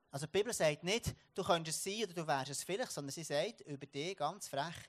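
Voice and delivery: normal talking voice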